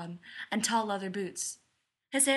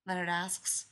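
ordinary delivery

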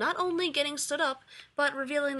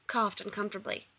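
ordinary delivery

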